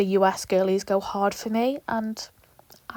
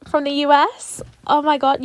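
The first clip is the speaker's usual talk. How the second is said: higher and louder, gushing to the US fans; squealing with excitement; hyped shout-out; 5 semitones higher, 8 dB louder than usual. Real, not acted. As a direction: blurt it out over the moon. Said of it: "you get me so hyped and excited".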